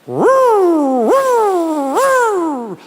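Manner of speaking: high, loud rising woo; car noise imitation. Customer to mechanic, mimicking loud car noise. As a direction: wail like a faulty engine. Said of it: "It keeps going".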